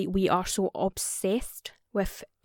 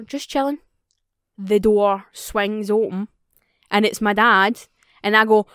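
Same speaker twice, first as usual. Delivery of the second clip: usual speaking voice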